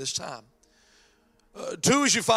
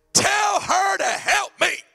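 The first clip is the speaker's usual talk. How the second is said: high, loud, slow demand; demanding shout; 10 semitones higher, 4 dB louder, much slower than usual. Retold or imitated. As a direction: bark the order at Jesus. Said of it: "I have to say it the way she said it".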